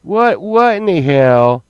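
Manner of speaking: loud, high what in the hell; shocked outburst. Real, not acted. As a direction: blurt it out in disbelief.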